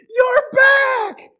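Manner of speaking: put-on high joyful shout of a young boy; thrilled kid yelling; excited shout. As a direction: cry out with delight at the lost dog's return. Boy greets returning dog.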